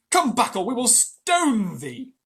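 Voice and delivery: loud, high and slow threat; angry crowd threatening Messua. A narrator's reading. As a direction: yell the threat to stone her. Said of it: "shouted the crowd".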